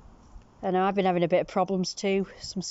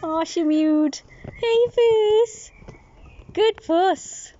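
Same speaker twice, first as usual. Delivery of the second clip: much higher, louder cooing; 11 semitones higher, 5 dB louder, much slower than usual. Real, not acted. Owner coos high at the friendly cat. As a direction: coo high and sweet.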